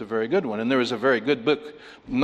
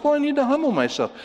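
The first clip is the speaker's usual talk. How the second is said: meek reply; 13 semitones higher, faster than usual. Retold or imitated. Self reply to God, higher.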